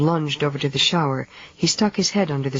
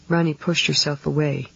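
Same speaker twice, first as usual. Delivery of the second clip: ordinary delivery, nothing marked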